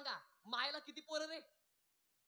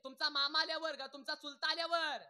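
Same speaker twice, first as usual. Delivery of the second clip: usual speaking voice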